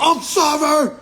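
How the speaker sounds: very loud, high-pitched proclamation; mock crazed yell; mock defiant shout. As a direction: holler it as a hammed-up courtroom outburst. Mimics outburst in court.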